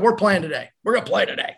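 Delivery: punchy, confident pep-talk quote; hyped-up teammate insisting; super positive. Retold or imitated. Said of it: "he's super positive about stuff".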